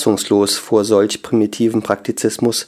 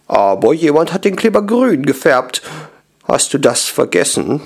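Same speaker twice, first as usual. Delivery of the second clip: countering; 5 semitones higher than usual. A narrator's reading. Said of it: "konterte Sebaldi".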